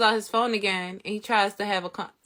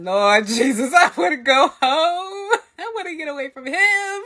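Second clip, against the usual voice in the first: mock desperate wail; 8 semitones higher, 7 dB louder than usual. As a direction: wail it like a hammed-up prayer for rescue. Acted prayer to escape the man.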